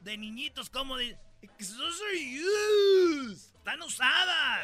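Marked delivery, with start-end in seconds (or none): 1.6-4.6 high, loud, very slow bratty wail; mock wail. Spoiled kid refusing used TV, high drawn mock wail. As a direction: whine it dramatically.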